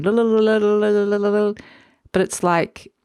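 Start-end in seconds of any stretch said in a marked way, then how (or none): 0.0-1.5 rapid trilled "la-la-la" on a higher held note; imitated wing flutter. Reader showing the haze of the wings going, fluttering trill. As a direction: flutter the tongue like whirring hummingbird wings. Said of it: "haze of the wings going".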